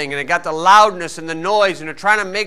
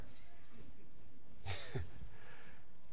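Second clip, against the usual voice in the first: breathy laugh; 18 dB quieter than usual. Real, not acted. Laughing at his own joke.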